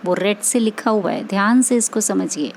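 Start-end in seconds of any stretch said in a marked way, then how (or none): none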